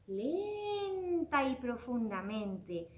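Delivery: lenta y profundamente, arrastrando las palabras; muy lento, como respirando hondo; muy despacio. Shown as a demonstration. (despacio y en calma)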